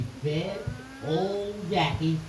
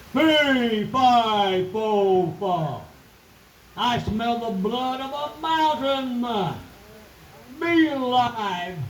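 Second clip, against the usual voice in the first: booming giant voice; 4 semitones higher, much slower than usual. Acted. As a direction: boom it out like a giant. Giant's fee fi fo fum.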